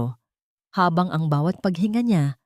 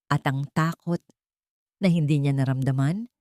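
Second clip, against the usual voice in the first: ordinary delivery, nothing marked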